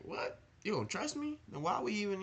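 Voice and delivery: high, outraged squeak of hurt disbelief; indignant disbelief. Retold or imitated. His younger self reacting to the group chat, shrill indignant disbelief. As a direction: act out his old offended self. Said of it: "I would be like".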